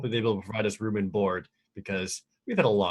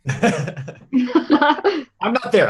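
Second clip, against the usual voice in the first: laughing; 10 dB louder, much slower than usual. Pretend. Joking he is absent from scene.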